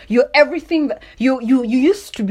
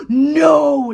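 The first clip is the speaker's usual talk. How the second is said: starstruck no; 4 semitones higher, 3 dB louder than usual. Pretend. Starstruck exclamation.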